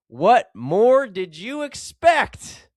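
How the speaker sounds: a mock exasperated punchline from God. God answers the drowned man, hammed-up exasperation. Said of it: "God replied".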